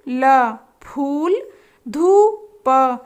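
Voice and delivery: very slow syllable drill; teacher building words slowly; slow sounding out. Shown as a demonstration. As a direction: stretch each part for children. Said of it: "उच्चारित करने के लिए कहेंगे".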